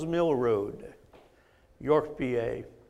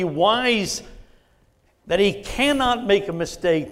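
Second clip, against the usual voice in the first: raised, emphatic pulpit voice; fervent preaching; 3 semitones higher, 3 dB louder than usual. Real, not acted. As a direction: build with conviction, punching the key words. Preaches at full force.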